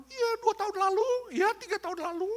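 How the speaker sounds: high, loud mock quarrel voice; dredging up the past. Spouse drags up old problems mid-quarrel, mock nagging. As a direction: nag shrilly about old grievances, hammed-up. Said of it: "ungkit-ungkit masa lalu".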